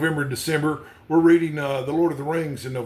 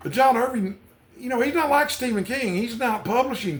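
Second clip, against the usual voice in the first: higher, eager and animated; bubbling over about a favourite author; excited; 9 semitones higher than usual. Real, not acted. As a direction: let real enthusiasm lift the pitch. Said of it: "I'm definitely excited about this".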